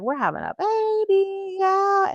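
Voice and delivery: high thrilled imitation of ex; excited husband. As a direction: gush like a new dad. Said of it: "He was just like".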